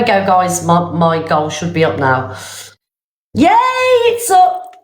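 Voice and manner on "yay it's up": high, sudden yay; delighted cheer. Real, not acted. Streamer seeing the goal finally working, delighted cheer.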